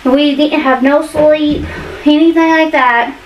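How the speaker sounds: ordinary talk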